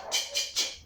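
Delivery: repeated whispered "ch, ch, ch" with no voice; teacher isolating the final "ch" sound; ch sound demo. Shown as a demonstration. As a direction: hiss out "ch" three times. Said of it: "is the final sound".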